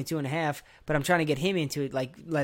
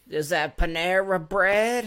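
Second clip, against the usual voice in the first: slow, higher, louder mock-puzzled foreign voice; puzzled foreigner; 5 semitones higher, 5 dB louder, slower than usual. Foreigner quizzing Americans, in a slow, puzzled put-on voice. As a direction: play a baffled tourist squinting at the menu.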